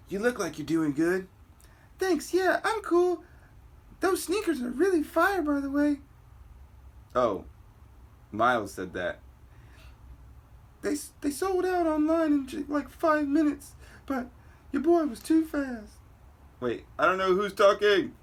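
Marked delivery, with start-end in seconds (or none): 2.0-6.0 high, light put-on girl voice; squeaky teen girl greeting her friend. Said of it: "I need to get my girl voice going". 10.8-15.9 high, light put-on girl voice; squeaky teen girl greeting her friend. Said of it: "I need to get my girl voice going".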